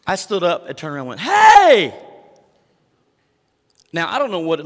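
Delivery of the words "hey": sudden furious bark of Hey!; irritated shout. Retold or imitated. Pastor reenacts yelling Hey at a rowdy kid, furious shout. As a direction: whip round and yell Hey! at the kids. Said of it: "before I could remember that I was a pastor".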